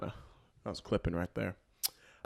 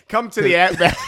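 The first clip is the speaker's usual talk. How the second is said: louder, higher hammed-up Australian accent; 6 semitones higher, 11 dB louder than usual. Host parrots his guest's stock phrase, mock Australian accent. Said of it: "give me an Australian accent".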